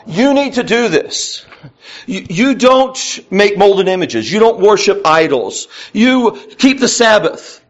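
high-pitched, insistent list of commands; emphatic preacher voicing God's commandments; God as commanding Father. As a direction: lay down the rules like a father, pitch climbing. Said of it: "in that tone".

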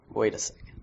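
hushed, breathy aside to himself; a soft mock whisper of sudden suspicion; whispered realization. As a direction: whisper it like you just noticed something. Realizes something in drawer.

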